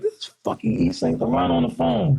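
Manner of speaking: retold astonishment. Telling his manager who is on the phone, astonished. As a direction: act out stunned disbelief at the caller.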